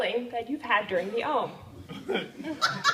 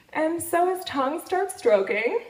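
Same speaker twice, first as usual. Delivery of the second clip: drawn-out slow; slower than usual. Retold or imitated. Describing his tongue to the audience, slow and drawn out. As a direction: savour each stroke, taking your time. Slow retelling of oral sex.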